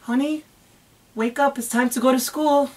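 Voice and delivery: put-on soft, high mommy voice calling a child awake; gentle wake-up call. Shown as a demonstration. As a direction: coax the baby awake like a morning routine. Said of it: "I'm gonna wake you up".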